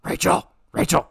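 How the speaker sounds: raspy warm-up growl; Batman. Shown as a demonstration. Co-host warming up a voice, raspy Batman. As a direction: rasp it out like a masked vigilante. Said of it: "It's my Batman voice".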